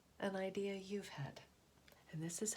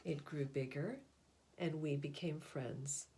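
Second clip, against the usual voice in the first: put-on gentle storybook narration for children; picture book reading. A narrator's reading. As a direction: read it like a bedtime picture book. Reads picture book aloud.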